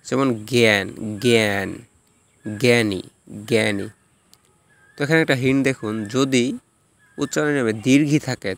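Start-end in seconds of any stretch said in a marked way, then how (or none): 0.5-3.9 জ্ঞান as "gyan", জ্ঞানী as "gyani"; separate, careful model of জ্ঞান and জ্ঞানী with a g sound; g with ya-phala. Shown as a demonstration. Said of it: "উচ্চারণ গয়".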